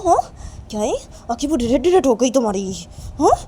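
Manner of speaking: loud telling-off, jumping high; scolding. Acted. Kajal scolds Reshma over her red eyes, angry. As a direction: scold her with sharp questions.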